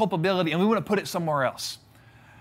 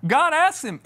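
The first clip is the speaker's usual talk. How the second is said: usual speaking voice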